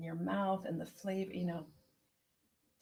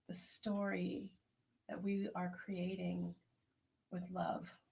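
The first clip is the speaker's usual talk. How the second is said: slow, soft, prayerful blessing; 4 dB quieter than usual. Acted. Host blessing the circle's tea, softly and slowly. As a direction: bless the listeners gently and slowly.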